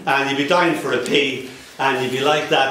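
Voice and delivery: normal talking voice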